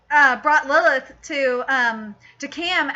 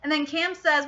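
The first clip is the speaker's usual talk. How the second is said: normal talking voice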